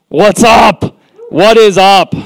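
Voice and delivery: high, loud hype-up call; big, booming-out excited greeting; shouted greeting. Real, not acted. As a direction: holler hello to a whole crowd.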